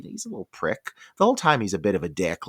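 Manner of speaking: ordinary delivery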